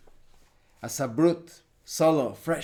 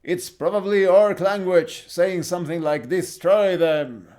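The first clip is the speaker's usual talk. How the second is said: loud, higher heroic character voice; Cruz; 3 semitones higher, 6 dB louder than usual. Acted. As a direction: declare it forcefully to the party. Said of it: "And Cruz replies".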